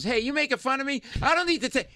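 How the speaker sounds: high, indignant challenge; offended drunk heckler. Retold or imitated. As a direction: imitate an aggrieved man picking a fight. Voices the angry slashed man confronting him.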